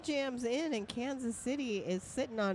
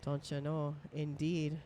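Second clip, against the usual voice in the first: put-on upper Midwest accent; 7 semitones lower, 7 dB quieter than usual. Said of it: "upper Midwest accent".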